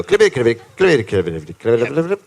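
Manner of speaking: very fast hoofbeat patter; galloping hooves. Pretend. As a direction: race through it, syllables tumbling like hooves. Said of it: "They're so fast".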